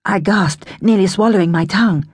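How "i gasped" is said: ordinary delivery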